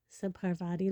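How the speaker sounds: ordinary delivery, nothing marked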